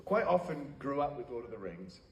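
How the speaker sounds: ordinary talk, nothing marked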